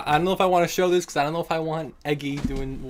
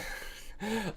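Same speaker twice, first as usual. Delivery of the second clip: soft high laugh; stifled laugh; 7 semitones higher, 12 dB quieter than usual. Real, not acted. Streamer reading about thighs, soft high giggle. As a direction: giggle softly, holding it in.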